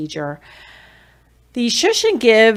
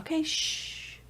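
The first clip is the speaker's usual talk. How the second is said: unvoiced hushing; soft shush; 9 semitones higher, 11 dB quieter than usual. Shown as a demonstration. Parent hushing a whining child softly. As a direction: hush the whining child gently. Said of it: "some kids respond to shh".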